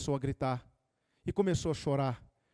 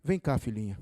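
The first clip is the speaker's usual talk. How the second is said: grandfather's voice; 6 semitones lower than usual. Retold or imitated. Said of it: "Aí o avô foi".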